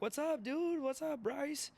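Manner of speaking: high, hyped bar greeting; excited greeting. Retold or imitated. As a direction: call out to your buddies, high and lively. Greets friends met in bar.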